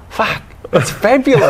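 high, gushing take on the mate; mock posh gush. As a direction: ham up his fancy praise. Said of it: "and he goes".

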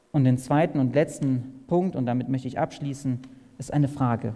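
usual speaking voice

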